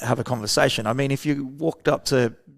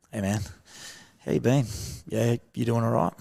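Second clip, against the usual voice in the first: gentle caring greeting; 5 dB quieter than usual. Shown as a demonstration. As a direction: ease in gently like checking on a mate who's struggling. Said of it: "we're already at that emotional tonality".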